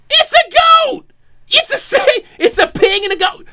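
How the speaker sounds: very high-pitched exasperated outburst; comic outrage. Acted. Ranter arguing goat is like pig, high exasperation. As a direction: protest in disbelief, voice shooting way up.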